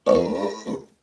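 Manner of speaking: a real burp after a gassy beer, with an "Oh" on it; loud gassy burp. Real, not acted. Host reacts to his fizzy bitter with a loud burp. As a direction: blurt out a sudden loud belch. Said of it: "this bitter is, uh, is a gassy wee fella".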